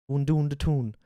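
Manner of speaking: tentative Geordie attempt; Geordie accent. Shown as a demonstration. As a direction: feel your way into Geordie. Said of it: "a phrase to get into it".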